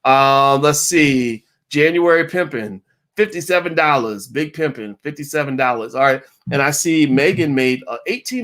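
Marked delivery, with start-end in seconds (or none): none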